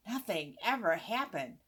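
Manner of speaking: ordinary delivery, nothing marked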